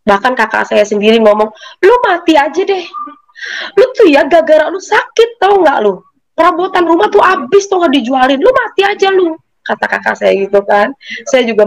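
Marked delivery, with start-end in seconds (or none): 1.8-9.3 high, heated retold rant; acted exasperated tirade, pitched up; angry scolding. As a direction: imitate a fed-up sibling lashing out. Acting out sibling's angry scolding.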